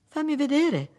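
put-on very high childish demand; insistent little girl. Daughter wanting to see father's face, high childlike insistence. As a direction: pipe up like a curious small daughter.

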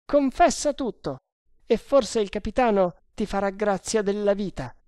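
high-pitched sergeant voice; urging sergeant. Acted. Sergeant offering prisoner mercy for confession, urging.

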